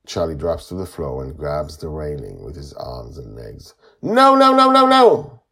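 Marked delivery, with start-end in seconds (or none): 4.0-5.4 put-on very high, loud panicked child; shouting. A narrator's reading. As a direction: scream a rapid string of refusals. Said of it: "he shouts".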